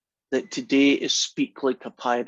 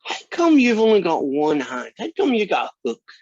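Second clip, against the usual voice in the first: put-on high-pitched kid's questioning; little kid; 9 semitones higher, 5 dB louder than usual. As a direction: pitch it way up, like an inquisitive child. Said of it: "He says".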